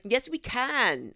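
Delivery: imitated drawn-out can; someone's way of can. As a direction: drag out the vowel in can. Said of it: "Like, she says".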